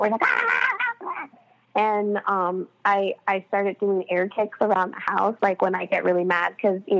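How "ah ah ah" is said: very high, strained "ah, ah, ah" cries; piercing high yelps of overload; overwhelmed wailing. Retold or imitated. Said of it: "so overwhelmed".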